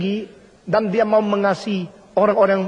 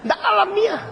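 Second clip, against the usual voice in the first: much higher, punchy exclamation; emphatic exclamation; 13 semitones higher than usual. Real, not acted. Preacher to congregation, emphatic high exclamation. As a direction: fling it out in disbelief. Preacher scorns fake politeness.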